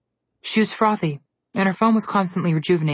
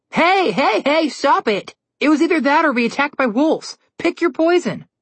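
high-pitched, loud protest; high defensive protest; 10 semitones higher, 4 dB louder than usual. A narrator's reading. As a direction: fend her off, half laughing. Brother fending off blows.

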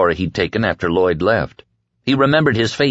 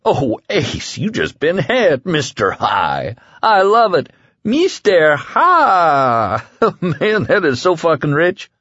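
high, hammed-up giggly teasing; giggling; 8 semitones higher, slower than usual. A narrator's reading. Man teases his friend over the new name, giggling. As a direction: squeal it through laughter, stretching the name. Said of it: "Hector Drogan was giggling".

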